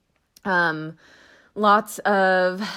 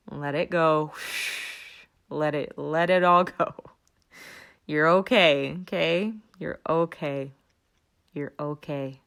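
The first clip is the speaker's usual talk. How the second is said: soothing reassurance; 3 semitones lower than usual. Real, not acted. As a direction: settle them gently, no rush. Calming a panicked listener.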